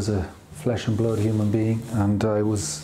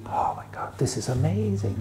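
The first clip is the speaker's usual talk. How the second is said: hushed, breathless wonder in a whisper; voiceless whisper of amazement, rising on amazing; awed whisper; 5 semitones higher, 4 dB quieter than usual. Retold or imitated. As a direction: whisper it like you've just seen something amazing. Awe at playing music.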